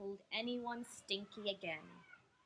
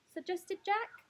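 usual speaking voice

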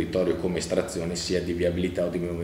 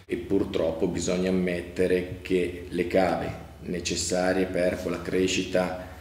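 ordinary talk

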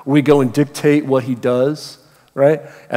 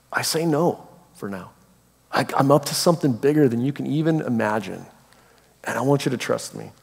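softer, gentle and sincere; tender reassurance; 7 dB quieter than usual. Acted. Jesus asking a follower to trust him, softly reassuring. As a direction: reassure quietly, easing down at the end.

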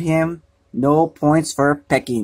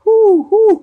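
high, loud mournful hoots; sad hoots; 5 dB louder than usual. A narrator's reading. Owl hooting sadly on being sent away. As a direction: hoot sadly, high and long. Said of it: "cried Owl sadly".

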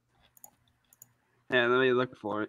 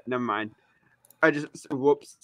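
usual speaking voice

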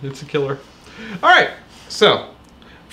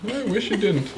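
usual speaking voice

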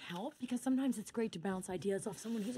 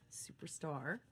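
low, soft, dejected farewell; deflated; 6 semitones lower, 7 dB quieter, much slower than usual. Acted. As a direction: let it drop quietly, hurt. Mom leaves after being turned down.

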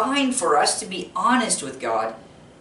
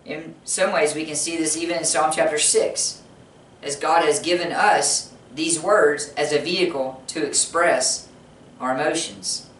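ordinary talk

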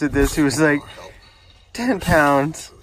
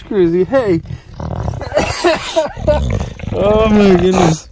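usual speaking voice

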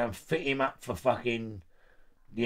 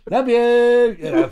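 laughing; 12 semitones higher, 11 dB louder, slower than usual. Retold or imitated. Laughing, retold nervous call.

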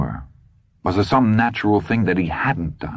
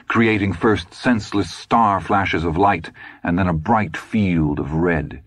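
plain reading voice

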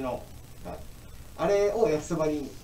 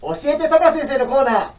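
excited announcer; 13 semitones higher, 13 dB louder than usual. Acted. Show intro announcement.

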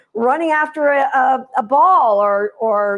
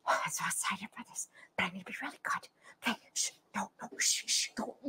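breathless whisper, rushing then hushing itself; a dog shushing its own excitement; excited dog whispering; 6 semitones lower, 20 dB quieter, much faster than usual. Pretend. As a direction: owner voicing a dog trying hard to stay calm.

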